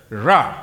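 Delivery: "ra" with the stress on the final sound; Hebrew pronunciation. Shown as a demonstration. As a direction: Hebrew "ra" said clearly as a teaching example. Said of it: "the accent is at the last letter".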